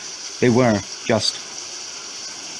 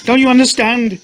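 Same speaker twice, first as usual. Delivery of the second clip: anguished cry; 13 semitones higher, 5 dB louder than usual. Acted. Wife pleading in anguish.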